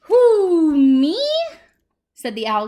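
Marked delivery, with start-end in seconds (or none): 0.0-1.2 high, very loud, long owl hoot. Acted. Owl astonished by the cactus's offer, hooting. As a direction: hoot the first word, swooping up at the end.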